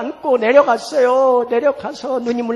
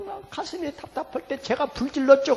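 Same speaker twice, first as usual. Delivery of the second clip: usual speaking voice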